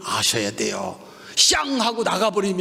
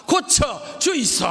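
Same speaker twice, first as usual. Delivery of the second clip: higher, slow Gyeongsang plea 고쳐주이소; Gyeongsang dialect; 7 semitones higher, slower than usual. Shown as a demonstration. Preacher leads the congregation in a Gyeongsang plea, called out. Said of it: "이때는 경상도 말이 괜찮아".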